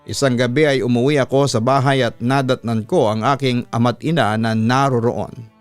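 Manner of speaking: ordinary delivery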